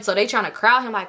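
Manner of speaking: ordinary delivery, nothing marked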